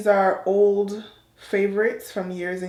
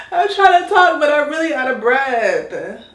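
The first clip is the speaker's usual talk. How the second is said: much higher and louder, a burst through heavy breathing; breathless exclaim; 6 semitones higher, 8 dB louder than usual. Real, not acted. To viewers mid-wash, a loud breathless complaint. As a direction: blurt it out gasping for air.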